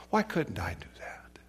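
whispered, soft self-questioning; hushed regret. Retold or imitated. Lost soul asks himself in hell, hushed regret. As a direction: let the voice drop to a regretful whisper.

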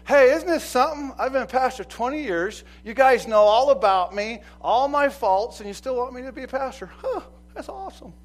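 high, loud delighted pastor voice; old pastor's boast. Retold or imitated. Pastor marking twenty years before the church, beaming. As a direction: crow it like a proud old pastor. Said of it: "he stood up here and he said".

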